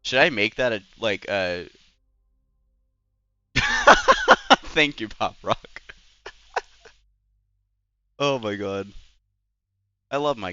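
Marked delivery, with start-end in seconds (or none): 3.5-4.7 loud high-pitched cackling laugh. Real, not acted. Streamer cracking up at chat, loud laugh.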